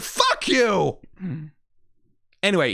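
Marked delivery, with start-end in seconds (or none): 0.0-1.0 high, heated yell; angry outburst. Real, not acted. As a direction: spit it at her. Raging at a hated character.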